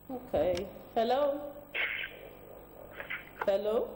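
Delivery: to someone hard of hearing. Real, not acted. Host calling caller on phone line.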